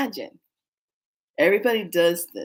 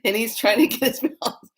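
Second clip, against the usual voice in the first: ordinary delivery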